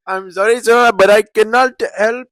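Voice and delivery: mock Indian call-center accent; polite agent in an Indian accent. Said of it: "practicing my Indian accent".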